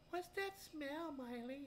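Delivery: high, slow mock kid asking. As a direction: squeak it out like a curious kid. Kids' friends voiced in story.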